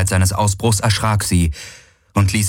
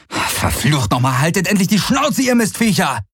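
higher, cursing yell at the birds; angry outburst; 11 semitones higher than usual. Acted. Galen cursing the squawking crows, furious yell. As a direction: let it burst out at the pests.

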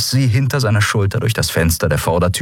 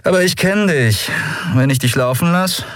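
higher-pitched, lightly voiced smug rogue; armed old rival, teasing; 7 semitones higher than usual. Gunman refusing to let an old acquaintance go, teasing. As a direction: tease her as someone you know too well.

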